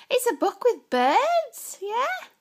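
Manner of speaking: high slow sing-song. Real, not acted. Showing baby a book.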